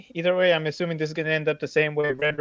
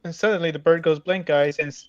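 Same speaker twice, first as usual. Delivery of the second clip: ordinary talk, nothing marked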